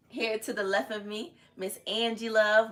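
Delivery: ordinary delivery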